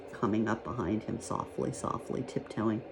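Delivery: soft, low tiptoe narration; sneaking along very softly; hushed, stealthy. A narrator's reading. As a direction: creep up on the listener, nearly whispering.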